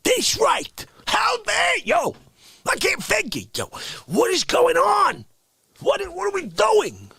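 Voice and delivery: outraged rant. Acted. Outraged ranting.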